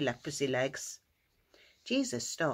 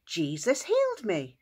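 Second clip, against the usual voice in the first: raised pitch, moderately excited; excited calling out; 4 semitones higher than usual. Acted. Healed man telling the town, calling out excitedly. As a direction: announce it with joy, holding a little back. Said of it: "he ran into town shouting".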